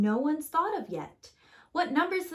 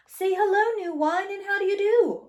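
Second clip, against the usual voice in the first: high, loud bright welcome, much higher; cheery greeting; 10 semitones higher, 7 dB louder than usual. Acted. Narrator welcomes new number four, high and loud.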